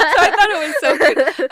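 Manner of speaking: high, loud, fast laugh-talk; laughing delight. Real, not acted. Host laughing at the Olive Garden mix-up. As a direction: gush between laughs.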